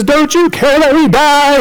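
high urgent plea; panicked disciples. Acted. Disciples shaking sleeping Jesus awake in the storm, panicked. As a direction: shake him awake with a high-pitched cry. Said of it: "And they shake Jesus".

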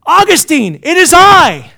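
high, very loud hail; acted woman's loud, high cry; woman calling out insistently. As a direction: shout to make him turn round. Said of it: "She goes".

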